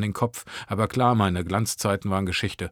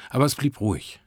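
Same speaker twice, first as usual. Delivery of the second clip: ordinary delivery, nothing marked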